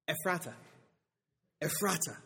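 "Ephrata" said carefully, then repeated the same; "Ephrata" set apart by pauses; careful pronunciation. Shown as a demonstration. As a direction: sound out "Ephrata" as a model for someone who can't say it. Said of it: "Doug can't say this word".

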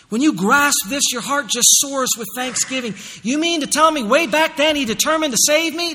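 loud, high, building call-and-answer preaching; full-force preaching. Acted. Pastor asking and answering for the congregation, building to a shout. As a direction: fire the questions, hammer each answer harder.

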